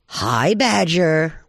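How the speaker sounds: long, drawn-out coyote hello; wheedling greeting. Acted. Hungry Coyote hails Badger to get food, drawn-out greeting. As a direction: stretch the greeting out slyly.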